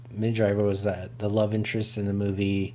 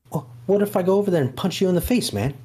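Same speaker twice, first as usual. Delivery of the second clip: high fast Boston tough guy; Boston accent; 10 semitones higher, 3 dB louder, much faster than usual. Acted. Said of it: "Now, see, that's can't do it".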